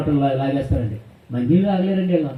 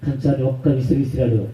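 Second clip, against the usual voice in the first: ordinary talk, nothing marked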